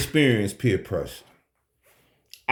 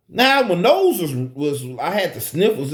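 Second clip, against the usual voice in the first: usual speaking voice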